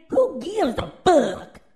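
shouted high and loud; loud outburst. Real, not acted. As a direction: blast the question out like a dare. Ranting at critics on his show.

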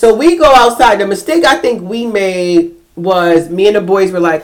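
ordinary talk

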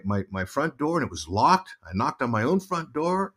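normal talking voice

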